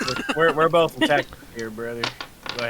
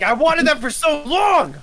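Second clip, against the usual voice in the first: excited; 12 semitones higher, 4 dB louder, slower than usual. Retold or imitated. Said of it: "He's like".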